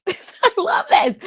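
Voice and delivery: slow, high, laughing "I love this"; laughing delight. Real, not acted. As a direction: laugh it out with joy.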